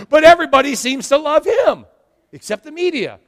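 high, loud shout; rising pulpit shout; preaching shout. Acted. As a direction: belt it to the crowd. Shout heard.

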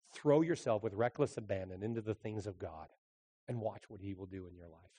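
ordinary talk

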